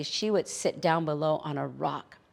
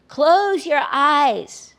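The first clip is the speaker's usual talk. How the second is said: high, loud and drawn out; slow loud call; 8 semitones higher, 11 dB louder, much slower than usual. Acted. Coyote calling down from the hill, slow and loud. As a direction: call it down slowly and loudly.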